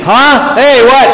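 high, loud startled huh; mother hearing the plate is empty; shocked mother. Acted. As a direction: jolt up in disbelief.

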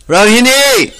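high loud call across the house. Acted. Yashoda calls Rohini from downstairs, loud high shout. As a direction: call her name up the stairs, pitched high and loud.

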